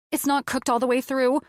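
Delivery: high, fearful cry; panicked outburst. A narrator's reading. Diner finds the chicken undercooked, panicked outburst. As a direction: blurt it high in rising panic. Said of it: "I was shouting in fear".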